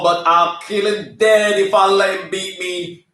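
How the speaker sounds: usual speaking voice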